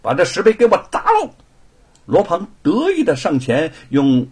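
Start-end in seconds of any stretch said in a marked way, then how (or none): 0.0-1.3 arrogant order. Acted. Young master orders stele smashed.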